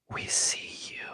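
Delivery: ghostly unvoiced whisper; eerie whisper. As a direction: breathe it like something watching. Dead head speaks ominously.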